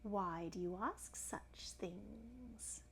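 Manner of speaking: very slow, soft crocodile drawl. A narrator's reading. Crocodile questioning the elephant's child, slow and soft. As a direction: ask softly, stretching every word. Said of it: "said the crocodile".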